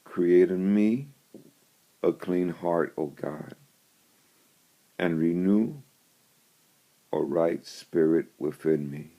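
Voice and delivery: measured, solemn Bible reading; scripture reading. Acted. Reads psalm.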